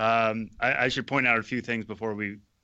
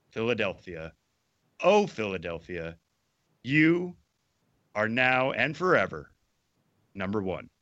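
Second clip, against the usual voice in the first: grand, swelling finale; fervent poem reading. Acted. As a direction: build to a passionate final vow. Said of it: "the appropriate level of fervor".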